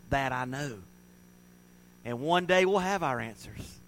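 ordinary delivery

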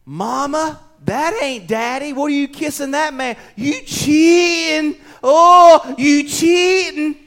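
high, drawn-out wailing accusation; loud, scandalized little-kid cry to mama; young child catching mom. Acted. Little boy confronting his mother about kissing, wailing accusation. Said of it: "if he sees you kiss another man".

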